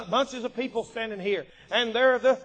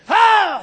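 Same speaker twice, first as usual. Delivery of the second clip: high, loud warrior's holler; sudden loud yell; battle yell; 10 semitones higher, 13 dB louder than usual. Retold or imitated. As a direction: let out a giant's war cry. Goliath's battle yell, acted.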